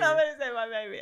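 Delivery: fast, loud, laughing hammed-up plea; mother mocking her own panic, fast and laughing; mock frantic plea. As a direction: rush it out as a joking melodrama.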